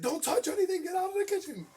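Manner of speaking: very high, loud grandma yell. Retold or imitated. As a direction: shoo strangers out of her kitchen. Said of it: "she was like".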